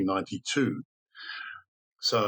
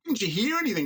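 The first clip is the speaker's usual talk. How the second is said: much higher, mock incredulous; retold disbelief; 12 semitones higher, faster than usual. Storyteller voicing neighbours questioning the sleeper, in mock disbelief. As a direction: imitate astonished neighbours, pitched right up. Astonished people asking boy, retold.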